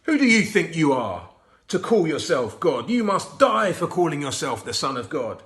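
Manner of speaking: loud, furious condemnation; angry accusation. A narrator's reading. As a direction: condemn him like an enraged judge. Leaders condemn Jesus.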